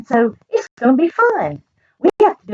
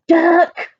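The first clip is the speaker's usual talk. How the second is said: high, loud shout of the name; scream; 9 semitones higher, 4 dB louder than usual. Acted. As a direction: yell it into the phone in exasperation. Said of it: "screamed Farmer Brown on the phone".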